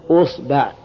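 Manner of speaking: "usba'", opening on u with a after the b; damma, then fatha. Shown as a demonstration. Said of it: "ضم الهمزة". (أصبع بضم الهمزة وفتح الباء)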